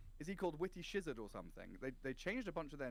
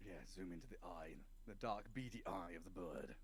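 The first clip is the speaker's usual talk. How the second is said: lower, hushed wildlife narrator; nature documentary; 6 semitones lower, 6 dB quieter than usual. Pretend. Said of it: "That's why you're such a good dungeon master".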